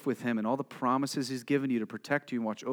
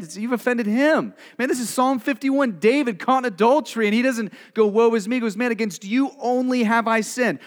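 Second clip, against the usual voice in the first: louder, higher impassioned preaching; preaching at full force; 6 semitones higher, 8 dB louder than usual. Real, not acted. Preacher on David's repentance, preaching at full force. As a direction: drive the message home with fervor.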